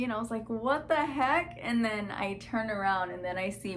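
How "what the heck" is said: high, slow, stunned "what the heck"; shocked disbelief. Retold or imitated. Reliving the moment she sees the proposal sign, stunned and drawn out. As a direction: let the shock stretch the words out.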